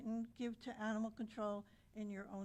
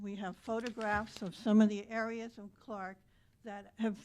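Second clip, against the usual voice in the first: loud, upset outburst; 11 dB louder than usual. Real, not acted. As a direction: raise the voice in real anger. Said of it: "I am very upset".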